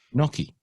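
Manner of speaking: "gnocchi" said "NOH-kee" with no G; Italian "gnocchi", G dropped; silent G. Shown as a demonstration. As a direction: say "gnocchi" without the G. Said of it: "Just like".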